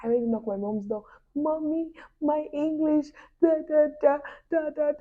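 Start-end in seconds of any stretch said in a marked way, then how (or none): 1.3-4.9 high, tearful wail to mom. Retold or imitated. Daughter wailing to her mom at the door, high tearful wail. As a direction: wail it like a heartbroken kid. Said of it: "I was wailing".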